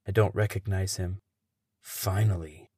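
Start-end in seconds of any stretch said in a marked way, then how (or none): 1.8-2.7 low, soft mumbled "Finally"; mumble. Acted. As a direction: mutter it under your breath. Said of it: "he mumbles".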